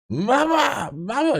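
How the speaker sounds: put-on high, far-off toddler wail; whiny toddler. Dad voicing his son wailing for mama while being held. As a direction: cry for mama like a tired toddler. Son voiced in bedtime story.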